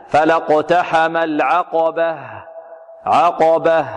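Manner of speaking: slow "فلا اقتحم العقبة" finishing on an audible "h"; clear final ha. Shown as a demonstration. Teacher shows a clear stop, "العقبة" with audible final h. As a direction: breathe out the h at the end of "العقبة". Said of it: "نوضح الهاء".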